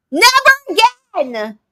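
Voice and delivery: high, loud, drawn-out exclamation; emphatic outburst. Real, not acted. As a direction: cry it out slowly in exasperation. Disgusted outburst about the date.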